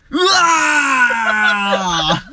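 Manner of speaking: long, loud, high wail of despair; anguished scream. Acted. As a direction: howl it out in despair. Despair at the result.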